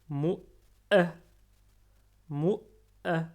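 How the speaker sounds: mou a avec le a appuyé et retenu; shadda appuyée. Shown as a demonstration. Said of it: "on appuie sur le alif. On le retient". (shadda bien appuyée sur mou a)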